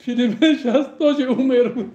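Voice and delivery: talking through high laughter; laughing. Real, not acted. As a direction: giggle the words out. Laughing about phone dying.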